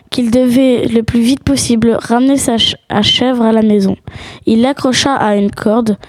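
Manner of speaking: ordinary delivery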